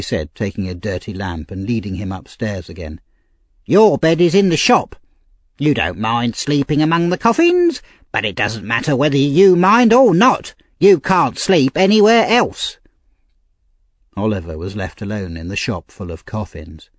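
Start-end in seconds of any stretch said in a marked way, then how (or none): 3.6-12.8 high, uncaring lady of the house; bossy mistress. A narrator's reading. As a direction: order the orphan about in a raised pitch.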